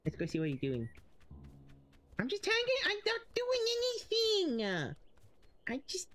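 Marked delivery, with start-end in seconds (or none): 2.2-6.1 very high, sing-song innocent goose; squeaky goose pretending to just watch. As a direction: play innocent in a tiny high voice.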